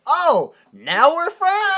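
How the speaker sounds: high, loud, drawn-out sarcasm; mock indignation. Genie throws friendship back at Max, loud sarcasm. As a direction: stretch it out, loudly affronted.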